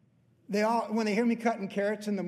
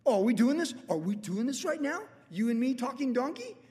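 exasperated Balaam; 6 semitones higher than usual. Retold or imitated. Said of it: "he's just like".

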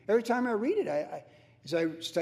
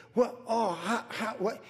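acted fretting, higher, broken into stray words; mock-worried stammer; 5 semitones higher, slower than usual. Preacher imitates a worried person lost for words, mock-anxious. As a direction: sputter half-formed questions as if worried. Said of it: "what it sounds like when you're worried".

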